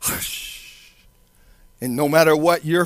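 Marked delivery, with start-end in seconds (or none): 0.0-0.9 drawn-out whispered hush. Shown as a demonstration. Preacher showing how the Word stills storms, whispered hushing. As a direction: let each hush trail into a long shh. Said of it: "is able to hush".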